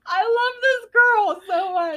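gushing delight. Retold or imitated. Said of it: "I'm like".

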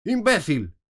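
loud, high scornful outburst; angry insult. A narrator's reading. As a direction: spit the insult at him. Queen insults the knave. (insulto furioso, alto y agudo)

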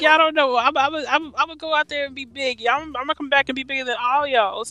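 high mock Stewie in a Mississippi accent, bragging he'll be big. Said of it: "with his Stewie accent, but with a Mississippi accent".

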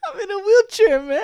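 high, loud mock crying; hammed-up tearful plea. Lalin begging betrayed friend Carlito, mock-tearful apology. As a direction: wail it like a pathetic whimperer. Acting Lalin pleading in wheelchair.

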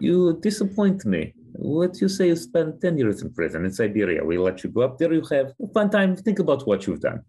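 playing Putin scolding a disappointing party member in a thick Russian accent. The host mocking Putin as a menacing villain, in a Russian accent. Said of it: "throw that Russian accent in there".

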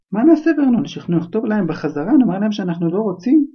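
frantic aunt. Acted. Aunt frantically asks husband.

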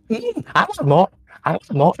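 very high, fast, excited creature voice; high squeaky head voice. Acted. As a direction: squeak it out like a silly giant head. First head craves more.

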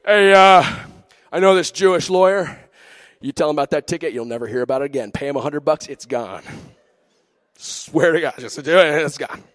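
cartoon henchman impression, loud on the greeting; Kronk. Church guy recommending a lawyer for the ticket, Kronk impression. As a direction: put on a dopey-confident character voice. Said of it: "he sounds like Kronk".

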